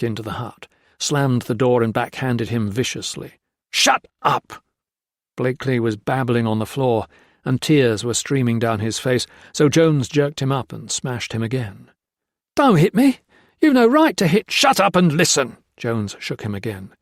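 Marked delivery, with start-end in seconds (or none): 3.7-4.6 angry snap. Acted. Jones strikes Blakely. 12.5-14.5 high, loud cry of terror; panicked plea. Acted. Blakely being beaten. 14.5-15.6 angry order. Acted. Jones beats Blakely.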